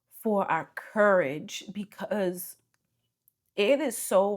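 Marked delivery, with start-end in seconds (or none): 1.7-2.6 a breathy whispered word. Real, not acted. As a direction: drop to a whisper. Whispered aside mid-thanks.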